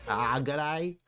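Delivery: imitating the Australian greeting, lightly; a quick playful Australian g'day; mock Aussie g'day. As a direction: echo the trailer's Aussie accent lightly. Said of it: "I just heard Rose Byrne".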